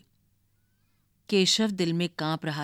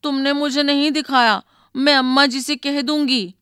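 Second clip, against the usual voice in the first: put-on higher and louder, a tearful child's complaint; a little girl near tears, threatening to tell; 6 semitones higher, 5 dB louder than usual. A narrator's reading. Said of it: "आंखों में आंसू भरकर कहा".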